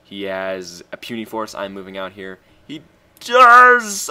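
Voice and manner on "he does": loud, much higher burst of hype; excited shout. Real, not acted. Gamer hyping his own play, excited shout. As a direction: yell it out in triumph.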